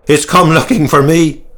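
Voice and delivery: high, loud wail of dread; hunted, panicky outburst; fearful cry. A narrator's reading. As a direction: cry out in despair. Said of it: "he cried".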